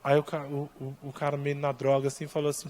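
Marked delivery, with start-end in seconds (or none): none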